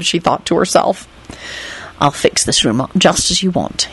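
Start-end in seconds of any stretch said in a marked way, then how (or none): none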